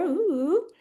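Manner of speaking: high, wavering mock-spooky ooh; playful "ooh". Host teasing about people joining in the future, playful ooh. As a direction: draw out a wobbly, teasing "ooh".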